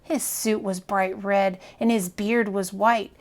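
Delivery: plain reading voice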